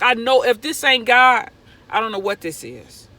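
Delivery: loud, high burst of joy; overjoyed. Retold or imitated. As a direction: shout out your amazement. Overjoyed sign from God.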